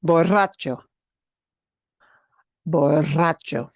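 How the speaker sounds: borracho with trilled rr; rolled r. Shown as a demonstration. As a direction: roll the rr in borracho. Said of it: "Easier when I go fast".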